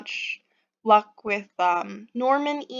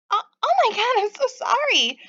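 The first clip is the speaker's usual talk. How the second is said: put-on much higher-pitched, flustered girl voice; flustered apology; 12 semitones higher than usual. Pixie apologizing to an angry stranger in the shop, flustered. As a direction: gush out a startled apology up high.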